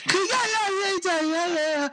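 very high-pitched mock gibberish; mock foreign girl. Girl in China talking on the app, high mock foreign gibberish. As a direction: squeal out made-up foreign chatter. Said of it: "talk to some girl".

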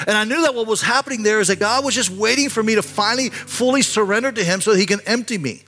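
high, insistent preaching voice; impassioned preaching. Real, not acted. Preacher telling the congregation about surrendering to God, impassioned preaching. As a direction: press the point home in a sustained high pitch.

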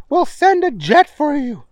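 high, bossy scientist voice; high professor voice. Acted. Stinkwell voiced.

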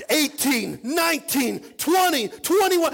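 high, loud count building to a peak; rising, escalating celebratory shout; excited shouted count. Retold or imitated. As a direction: count the reps like a cheering crowd. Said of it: "And we're all shouting".

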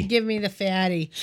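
ordinary delivery

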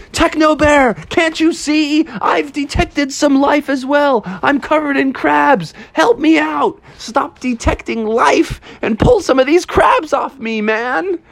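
very high-pitched frantic bear; exasperated pleading. Acted. Bear begging Techno Bear to help, high exasperated wail. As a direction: beg and scold in hammed-up distress.

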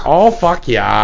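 slow mock moan in Minnesota accent; Minnesota coming. Host echoing co-host in the sound bite gag, Minnesota accent. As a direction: draw out a hammed-up Minnesotan climax. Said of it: "Minnesota coming".

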